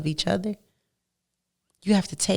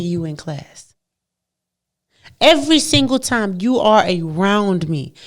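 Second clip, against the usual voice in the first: ordinary talk, nothing marked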